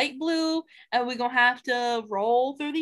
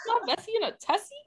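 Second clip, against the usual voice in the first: put-on fast, higher cutesy baby talk; 9 semitones higher, faster than usual. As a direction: gush in a cute voice. Cutesy repeat.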